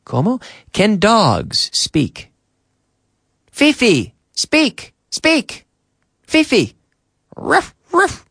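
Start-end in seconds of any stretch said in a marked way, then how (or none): 3.5-6.8 high-pitched mock dog commands; calling a dog. Teacher playing an owner commanding Fifi to speak, high dog-calling voice. 7.4-8.2 high little mock woofs; imitated dog bark. Teacher plays Fifi answering the command, high imitated barking.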